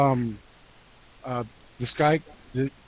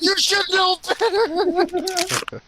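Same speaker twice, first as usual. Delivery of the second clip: mock scolding; 11 semitones higher, 4 dB louder, slower than usual.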